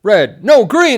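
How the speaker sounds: hammed-up comic panic; panicked correction. Acting knight's panicked wrong answer.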